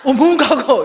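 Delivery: loud, high startled retort; startled question. Retold or imitated. Friend reacts to being asked about her past, startled. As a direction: blurt it back, high and loud.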